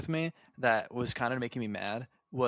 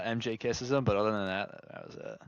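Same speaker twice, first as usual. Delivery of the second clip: ordinary talk, nothing marked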